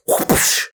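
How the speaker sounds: voiceless rush of air; imitated punch whoosh. Teller mimics brother's fist flying, breathy whoosh. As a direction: blow out a swishing punch sound.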